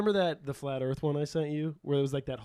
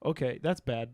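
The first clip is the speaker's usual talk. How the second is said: usual speaking voice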